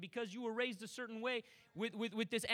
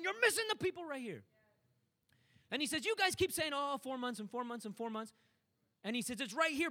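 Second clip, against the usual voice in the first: higher, pressing urgency; raised preaching; 4 semitones higher than usual. Acted. Preacher voicing Jesus rebuking disciples, raised urgent preaching. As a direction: push it at the disciples.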